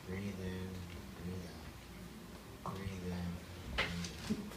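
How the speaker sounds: soft, low breathing cues; imitated meditation guide. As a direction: quietly mimic a soothing meditation voice. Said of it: "this beautiful woman's voice came on".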